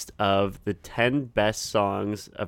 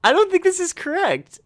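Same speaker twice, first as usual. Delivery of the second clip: squeaky, much higher mock protest; high mock outrage; 7 dB louder than usual.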